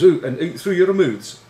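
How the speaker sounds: high, loud mock-Scottish command; urgent instruction in a put-on Scottish accent; emotional Scottish captain. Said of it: "this very emotional speech".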